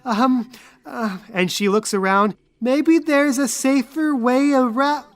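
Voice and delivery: put-on high girlish NPC voice, a little huffy; Angel's voice. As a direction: play the timid girl, pitched well up.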